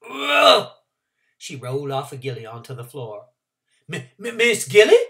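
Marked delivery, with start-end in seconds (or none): 0.1-0.7 loud straining grunt; effortful grunt. Acted. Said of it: "Trotter grunted". 3.9-5.0 anxious. Acted. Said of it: "he was asking anxiously".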